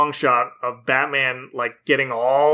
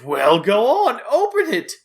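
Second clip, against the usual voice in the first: high, goading cartoon villain; Joker; 9 semitones higher than usual. Villain urging the hero to open a present, high playful goading. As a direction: egg him on, pitch climbing sharply. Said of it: "Joker tosses Batman a present".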